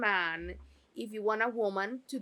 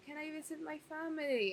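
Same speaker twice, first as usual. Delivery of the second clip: small high timid plea, quicker; pleading; 7 semitones higher, 7 dB quieter, faster than usual. Retold or imitated. Penelope asking Lady Danbury meekly. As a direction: ask meekly in a raised voice. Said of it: "Lady Danbury would say".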